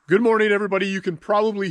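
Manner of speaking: blocked nose, head cold; stuffed up voice. Real, not acted. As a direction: speak through a stuffed nose. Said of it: "So I'm really stuffed up".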